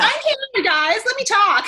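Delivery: high, loud mock plea to be included; clamouring for attention. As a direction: call out over their conversation. Acted butting into others' conversation.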